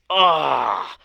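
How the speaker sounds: loud, high "oh" of dawning horror; imitated anguished groan. Coach imitating a client facing her shadow, anguished groan. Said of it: "she's like this".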